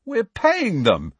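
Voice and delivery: high, drawn-out indignant retort. A narrator's reading. Fred defends himself to Hermione, indignant. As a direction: stretch it out in high-pitched protest. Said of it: "said Fred indignantly".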